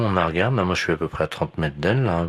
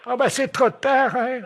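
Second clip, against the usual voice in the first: high, slow, laughing granny; old lady voice; 3 dB louder, much slower than usual. Pretend. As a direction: giggle like a naughty granny.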